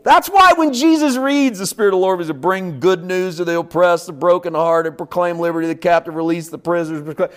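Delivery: high, very loud impassioned preacher shout; full-force preaching. Real, not acted. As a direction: shout it out to the congregation, stretching key phrases.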